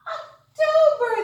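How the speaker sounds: high, loud announcement; excited reveal. Acted. Teacher excitedly reveals the new month to kids. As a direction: burst out with the answer.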